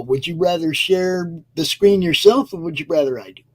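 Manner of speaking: ordinary talk, nothing marked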